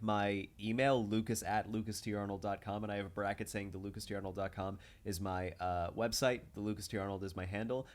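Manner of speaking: ordinary talk, nothing marked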